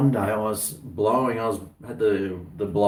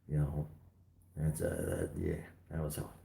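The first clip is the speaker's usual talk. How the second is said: normal talking voice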